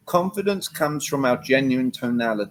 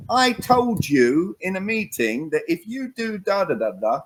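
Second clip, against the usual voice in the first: mock scolding, high and slow on "I told you"; a reactive boss reminding an employee; confrontational leader; 7 semitones higher, slower than usual. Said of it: "instead of being a leader that is conflict".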